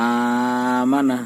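আমানা with the first আ drawn out very slowly; madd lengthening. Shown as a demonstration. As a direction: stretch the opening আ of আমানা by one alif. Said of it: "আমানা বলতে হবে, প্রথমটা একালিপ টান হবে". (আমানা প্রথম আ এক আলিফ টেনে ধীরে)

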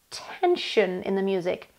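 ordinary delivery

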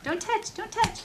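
real parent's high, repeated caution. Real, not acted. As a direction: pitch it way up to warn a little one. Warning a small child off.

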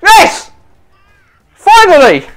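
very high, loud cheer; triumphant cheer. Real, not acted. Player finally winning a roll, high triumphant cheer. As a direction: cheer the long-awaited win.

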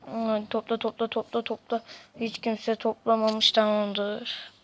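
normal talking voice

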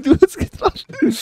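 words squeezed out through a laugh. Real, not acted. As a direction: still giggling as you speak. Laughs mid-game banter.

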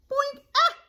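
high, loud "boink" and startled squawk; sudden cartoon bonk, then a sharp yelp. Acorn hits the chick on the head, startled squawk.

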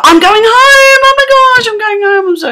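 very high, loud squeal of joy; squealing with excitement about going home; excited squeal. Real, not acted. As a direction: squeal it like you can't contain it.

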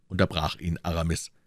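plain reading voice

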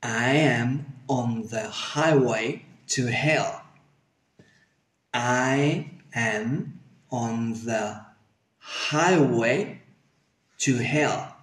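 slow, every word separate and distinct; clear intermediate pronunciation. Shown as a demonstration. Teacher gives learners an easier model, slow careful pronunciation. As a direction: spell out the phrase slowly, word by word. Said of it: "buscaremos el punto de pronunciación".

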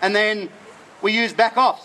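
ordinary talk

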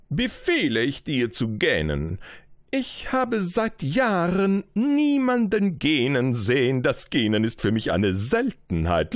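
a proud king beckoning his subject. Acted. King commands yawning.

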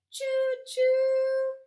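high, loud choo choo like a steam whistle; train whistle call. A narrator's reading. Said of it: "Papa Pufferbelly cheered".

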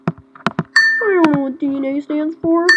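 ordinary talk, nothing marked

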